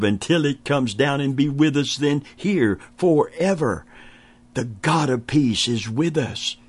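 ordinary talk, nothing marked

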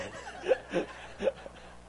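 short wheezy chuckle without words; laugh. Real, not acted. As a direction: chuckle at your own joke. Laughs at cleaners joke.

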